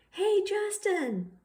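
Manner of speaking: high slow call across the yard. Acted. Emilio calls Justin from the tetherball court, high and drawn out. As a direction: call him over from far away. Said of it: "Emilio calls".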